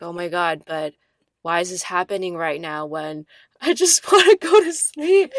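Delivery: loud whiny laugh through the words; laughing frustration. Retold or imitated. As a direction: moan it while laughing. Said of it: "And, like".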